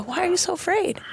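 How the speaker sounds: tender reassuring Jesus; empathetic. Retold or imitated. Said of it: "it's more empathetic".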